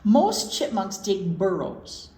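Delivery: normal talking voice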